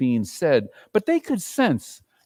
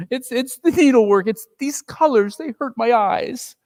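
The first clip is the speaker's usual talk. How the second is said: higher, stammering mother; mother hiding tears; 9 semitones higher, 5 dB louder than usual. Acted. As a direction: brush it off, covering tears.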